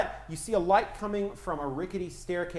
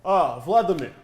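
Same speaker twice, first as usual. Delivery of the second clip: put-on Russian accent; 7 dB louder than usual. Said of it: "Russian accents".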